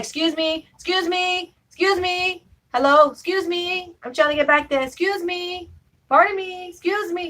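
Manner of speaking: high, repeated polite excuse me. Retold or imitated. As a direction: squeeze past people politely but insistently. Pushing through crowded bus.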